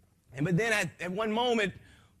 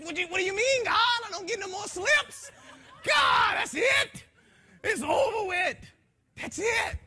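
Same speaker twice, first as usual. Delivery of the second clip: much higher, crying out to God in alarm; a man pleading with God, voice high in panic; panicked plea; 14 semitones higher, 4 dB louder than usual. Retold or imitated. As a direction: shout it up high, desperate and frantic.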